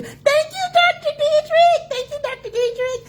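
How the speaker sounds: squealed high thank-you; bright high greeting to a viewer; high excited thanks. Real, not acted. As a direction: gush thanks to a colleague online.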